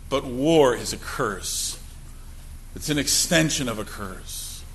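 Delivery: low, soft and grave; solemn lament with long pauses; somber, hushed preaching. Real, not acted. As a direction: speak quietly of grief, letting phrases sink. Preacher grieves over war deaths.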